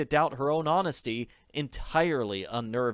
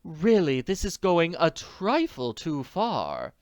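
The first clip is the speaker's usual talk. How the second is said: impatiently; 6 semitones higher, 3 dB louder, slower than usual. A narrator's reading. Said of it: "the manager said impatiently".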